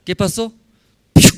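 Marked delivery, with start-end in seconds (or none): 1.1-1.3 sharp whistled piu of a flying stone; stone whizzing sound. Acted. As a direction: make the stone fly past with your mouth. (silbido de piedra volando)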